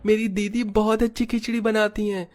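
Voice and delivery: put-on a small child's high voice, begging the customer; pleading child. Younger sibling begs the customer to try his sister's khichdi, high childlike pleading. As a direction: plead like a little kid who wants a sale.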